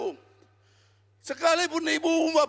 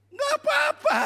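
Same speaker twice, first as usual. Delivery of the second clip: high reassuring cry; 11 semitones higher than usual. Retold or imitated. Preacher voicing his own reply.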